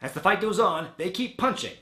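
normal talking voice

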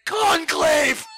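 high, loud shouted word; shouting the name read off the page; shocked shout. Retold or imitated. Retold reading the shocking page.

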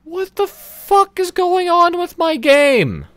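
shrieking slowly in high-pitched rage; frustrated high shriek. Real, not acted. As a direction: squeal it out, fed up with the game. Game freezing, streamer raging.

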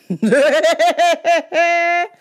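high burst of real laughter; loud laugh. Real, not acted. Laughing after retelling the yelling at the customer. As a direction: crack up at your own story.

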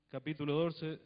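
ordinary talk, nothing marked

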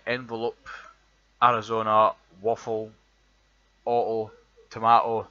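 lays every word out clearly, showing off the Edinburgh sound; Edinburgh accent word list. Shown as a demonstration. Reading a word list to show his Edinburgh accent, loud and deliberate. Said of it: "the words that we've got".

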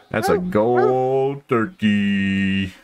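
very drawn-out, crooned celebration; slow, sing-out trophy brag; gloating delight. Real, not acted. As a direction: savor the prize, stretching each word way out. Celebrating a gold-rated trophy.

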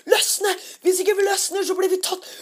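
mock-panicked plea to get away; panicked urging. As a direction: hurry your friends in a half-crying panic. Panicked kids retelling escape.

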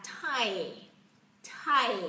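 "tie" stretched into a long final "ee"; drawn-out glide. Shown as a demonstration. Teacher modelling for learners, long-glide "tie". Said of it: "chúng ta phải kéo ra y ở trong âm I".

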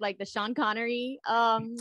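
ordinary talk

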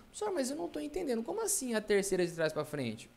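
high-pitched, rushed student protest; confused student. Acted. Confused student interrupting the teacher, high voice.

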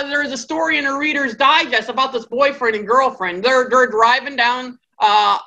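ordinary delivery

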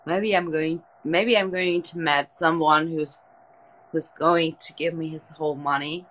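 ordinary talk

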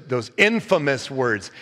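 ordinary talk

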